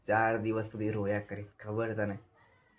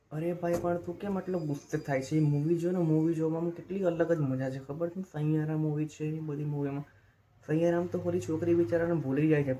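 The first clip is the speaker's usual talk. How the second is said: Saiyaara fan friend; 5 semitones higher than usual. Acted. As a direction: play the gentle friend who loves Saiyaara. Second character defends Saiyaara movie.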